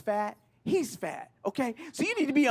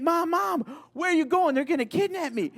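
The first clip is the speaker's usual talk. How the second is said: higher, louder mock-panicked calling; panicked child; 8 semitones higher, 3 dB louder than usual. Comic as kid calling after mom, mock panic. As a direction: call out like an alarmed kid.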